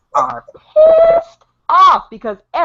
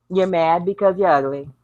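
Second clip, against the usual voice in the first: ordinary talk, nothing marked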